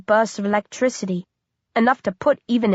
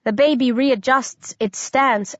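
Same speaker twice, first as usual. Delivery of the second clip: plain reading voice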